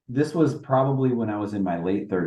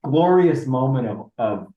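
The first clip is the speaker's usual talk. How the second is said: ordinary talk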